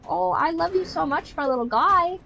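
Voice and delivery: high, adoring coo to a cat; baby-talk affection, lifted high; gushing pet talk. Retold or imitated.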